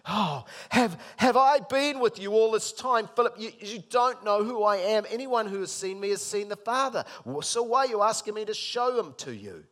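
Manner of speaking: loud, high exasperation; a teacher whose students never got it; loud weary exasperation; exasperated Jesus. Retold or imitated. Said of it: "rolling his eyes going".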